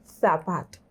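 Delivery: "Zapato" with the z as a Castilian lisped th, tongue between the teeth; "Zapato" with a Castilian th sound for the z. Shown as a demonstration. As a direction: say "Zapato" the Spain way, z as th. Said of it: "pero dilo con acento español".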